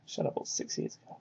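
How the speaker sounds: fast whispered aside to himself; whispering. Real, not acted. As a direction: whisper it under your breath, quickly.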